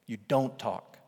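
ordinary talk, nothing marked

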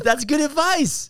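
high, loud, slow and laughing; real laughing exclamation; tickled approval. Real, not acted. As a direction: squeal it out through laughter. Cracking up at own punchline.

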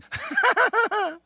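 high-pitched put-on laugh; exaggerated high laughter; demonstrated laugh. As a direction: laugh it out high as a polite response. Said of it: "I've responded like this".

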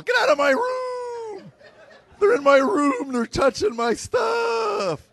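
squealing teenage son, hammed-up; shrill kid yell. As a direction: shriek it like a teen whose voice never broke. Son yelling, voice never dropped.

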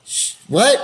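high, jolted double take on what; a sudden shocked yelp of a question; stunned disbelief. Retold or imitated. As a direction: caught completely off guard by the news. Stunned by agent's offer news.

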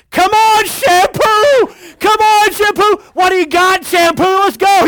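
put-on high, loud little-kid cheering; excited toddler. Father imitating his son taunting the whale, high and loud. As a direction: egg the whale on like a fearless toddler. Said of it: "Trevor's saying".

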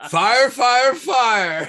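high, loud fire call; fire alarm shout. Retold or imitated.

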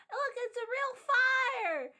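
put-on high-pitched, awestruck child exclamation; amazed kids. Kids see fire in a hand, amazed high voice. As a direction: marvel like an excited child. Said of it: "Everyone's like".

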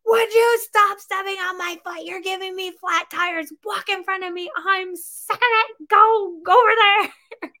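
high, loud mock yelling at a child; frazzled mom yelling at her kid for stepping on her foot; retold angry yell. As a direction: imitate a fed-up mom snapping at her kids, pitched high. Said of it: "all out yells at him".

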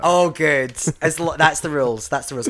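loud, high delighted approval; delighted gasp. Real, not acted. Approving a fellow queen's paid gigs, loudly delighted. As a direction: gasp then cheer her on loudly. Reacting to being paid before.

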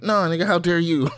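hammed-up indignant counterattack; indignant retort.

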